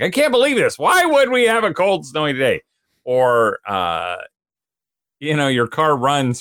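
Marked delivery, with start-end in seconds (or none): 0.0-2.6 high, loud exasperated whine; mock disbelief. Dad mocking himself about spring snow, high whiny disbelief. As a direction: act outraged at the snow. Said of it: "I'm like".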